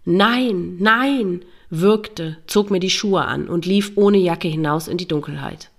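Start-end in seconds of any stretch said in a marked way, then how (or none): 0.1-1.4 restrained narrated cry, the second rising higher; screamed denial. A narrator's reading. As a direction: cry it out in mild anguish, each word drawn and falling. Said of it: "Ich schrie".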